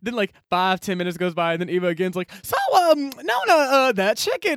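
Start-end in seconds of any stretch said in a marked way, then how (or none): 2.4-4.5 high, loud, halting mock hint; awkward prodding. Eva pesters Nona about that chicken, high awkward prodding. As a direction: stretch each hesitation while fishing for an answer.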